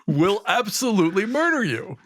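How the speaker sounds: usual speaking voice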